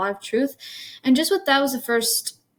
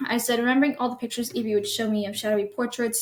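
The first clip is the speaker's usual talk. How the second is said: ordinary delivery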